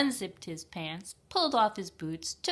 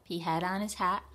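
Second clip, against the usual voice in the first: ordinary delivery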